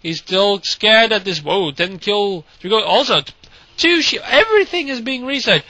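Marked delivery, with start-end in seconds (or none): none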